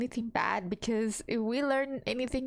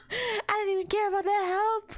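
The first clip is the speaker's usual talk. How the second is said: laughing through the words, higher; 8 semitones higher, faster than usual. Real, not acted. Player laughing at herself, giggling through it. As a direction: giggle while saying it.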